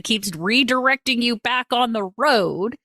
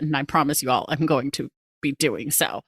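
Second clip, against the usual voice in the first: ordinary talk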